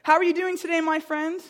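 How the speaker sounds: teacher greeting a student brightly; cheery teacher. Retold or imitated. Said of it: "He goes".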